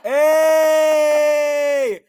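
loud, high, thrilled yells; excited shout. Real, not acted. Gamer watching his fireworks go off, yelling with joy. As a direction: shout with delight as the fireworks burst.

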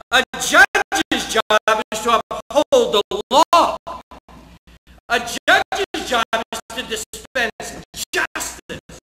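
loud, slow, high pulpit declaration; preacher thundering about a judge's duty; full-force preaching. Acted. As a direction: hammer each line home from the pulpit.

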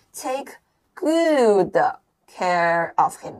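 slow, separated TAKE, GOOD, CARE OF HIM; word by word. Shown as a demonstration. Teacher fills the blanks for students, word by word. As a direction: break it up with a pause after TAKE and GOOD. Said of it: "连到一起".

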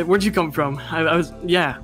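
normal talking voice

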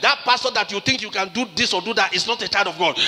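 ordinary talk, nothing marked

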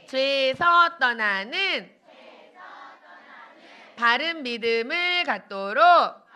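very slow, drawn-out prayer phrases. Real, not acted. Leads children in repeated prayer.

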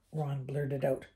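usual speaking voice

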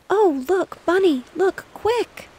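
high, loud alarmed call; loud high urgent cry. Acted. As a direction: call out to her brother in alarm. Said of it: "caused her to cry out".

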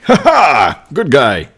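loud, high heroic laugh and cry; good guy voice. Acted. Player swaps villain for good guy, loud hero voice. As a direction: burst out like a hammy hero. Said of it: "I have to do a good guy voice".

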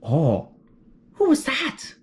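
ordinary delivery

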